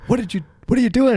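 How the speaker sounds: high, fast mom's alarmed question. Retold or imitated. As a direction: play the mother catching her son. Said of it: "and my mom goes".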